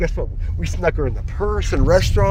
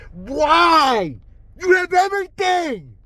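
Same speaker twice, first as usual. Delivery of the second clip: exasperated outburst; 11 semitones higher, 6 dB louder, slower than usual. Real, not acted. Ranting at the Kremlin.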